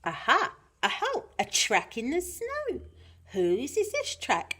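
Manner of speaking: high, excited little explorer; Gruffalo's child. Acted. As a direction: squeal lightly at a new find, pitch leaping. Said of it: "the Gruffalo's child".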